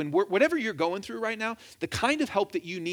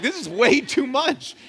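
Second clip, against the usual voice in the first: retold exasperation, higher and louder; overwhelmed; 5 semitones higher, 5 dB louder, slower than usual. Preacher recalling his attempt to count verses, overwhelmed. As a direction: throw it up high, overwhelmed by the count.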